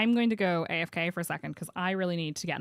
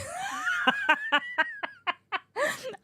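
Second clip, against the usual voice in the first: high shrieking laugh. Real, not acted. Streamer cracking up at chat, shrieking laugh.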